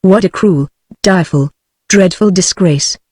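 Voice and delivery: ordinary delivery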